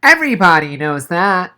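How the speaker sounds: put-on high, nasal-free kid voice blurting out the correction; Noah blurting. A narrator's reading. Noah correcting the teacher, blurted in a high voice. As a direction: blurt it like a know-it-all. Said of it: "Noah blurted".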